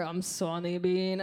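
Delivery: slow, low spooky call; ominous drawn-out horror voice; Sawney Bean. Acted. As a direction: drawl it out like a cannibal in the dark. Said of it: "and we can hear".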